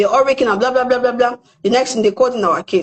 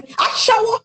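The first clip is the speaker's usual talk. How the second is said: mock shout; 12 semitones higher, slower than usual. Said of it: "I was shouting".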